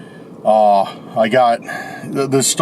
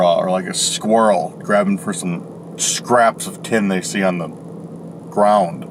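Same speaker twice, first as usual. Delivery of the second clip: ordinary talk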